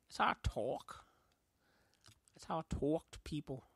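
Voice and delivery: put-on New York talk, punchy; New York accent. Repeating how he talks to people, New York accent. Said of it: "Like that New York accent I did".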